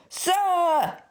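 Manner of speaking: high bawled "Sir!". A narrator's reading. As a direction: bawl it at a deaf customer. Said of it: "bawled the assistant at the top of his voice".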